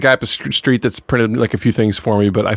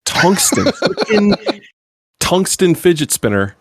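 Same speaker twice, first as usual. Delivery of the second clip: amazed laughing; 7 semitones higher, much slower than usual. Real, not acted. Marvelling at a tungsten spinner.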